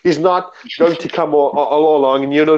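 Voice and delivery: ordinary delivery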